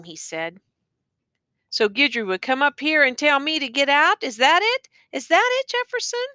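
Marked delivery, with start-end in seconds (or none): 1.7-6.3 high, pressing questions; restrained anger. A narrator's reading. Teacher confronts the taunting prisoner, exasperated and rising in pitch. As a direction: challenge him while holding anger back. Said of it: "trying my best not to become angry".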